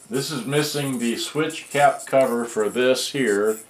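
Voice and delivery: ordinary delivery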